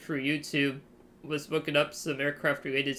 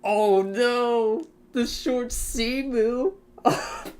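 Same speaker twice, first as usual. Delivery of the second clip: horrified delight; 7 semitones higher, 5 dB louder, much slower than usual. Real, not acted. Remembering an even uglier plane.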